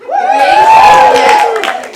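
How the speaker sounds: a loud delighted whoop; laughing shout. Real, not acted. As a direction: burst out in a high laughing shout. Laughing cheer about the bride.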